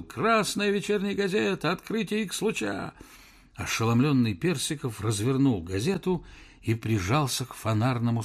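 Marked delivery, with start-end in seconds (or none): 0.0-2.9 high hawking call; newsboy shout. Acted. Newsboy yells price at professor. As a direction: sell it like a street boy. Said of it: "вновь завыл".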